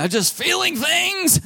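very high, drawn-out comic whine breaking into laughter; mock emotional outburst. Preacher mocking men who can't name feelings, comic outburst. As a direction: stretch it out high as a helpless joke.

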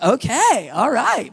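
high, loud, swooping agreement; amazed acceptance. Retold or imitated. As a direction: re-enact an astonished dad going along with it.

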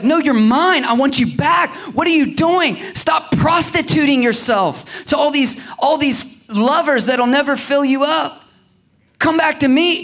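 high, loud, impassioned plea; righteous jealousy. Acted. God to his cheating bride, righteously jealous. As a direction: cry out like a jealous husband. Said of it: "there's a righteous jealousy, and he's like".

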